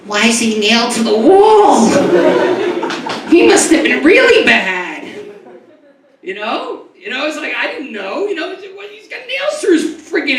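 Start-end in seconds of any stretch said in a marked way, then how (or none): 0.1-5.0 put-on child's voice. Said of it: "I'm like".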